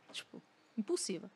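normal talking voice

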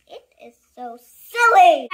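loud exasperated cry; exasperated outburst. Acted. Reader fed up with the book, exasperated. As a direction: burst out at the silliness.

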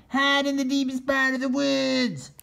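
high urgent turkey call. Acted. Solomon warning the turkeys to hide in the woods. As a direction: urge them to hide, high and pressing. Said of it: "shouted Solomon".

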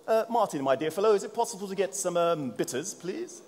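put-on high-pitched, polite English accent; best English accent. Presenter asking colleague Martin for bitters, mock English accent. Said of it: "That's my best English accent".

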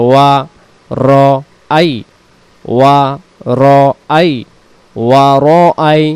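ওয়া র আই split apart, each part separate; syllable by syllable. Shown as a demonstration. Teacher spelling out the syllables for students. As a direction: tap out ওয়া, র, আই slowly. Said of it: "উচ্চারণ হবে".

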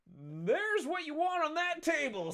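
very high, slow, laughing squeak; waiter voice. Acted. Waiter pointing to the table, high laughing squeak. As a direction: squeak it out while cracking up. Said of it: "this is a one-time character".

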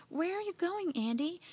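high-pitched eager question; crying out. Acted. Mildred questioning Andy at the gate, crying out. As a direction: ask it in a high, calling voice. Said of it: "she cried".

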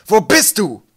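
high, very loud demanding yell; bellow. A narrator's reading. Captain calling for his missing mate, bellowing. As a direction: roar the question into the night. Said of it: "brüllte er in die Nacht hinaus".

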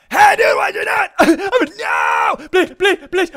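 loud, high frantic pleading; high gamer panic shouting; panicked yelling. Real, not acted. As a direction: beg at the top of your voice. Attacked by opponent in game.

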